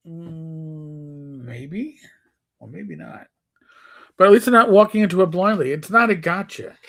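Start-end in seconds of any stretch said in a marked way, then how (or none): 0.1-3.3 hesitant whisper. Retold or imitated. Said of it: "normal adventurers would go".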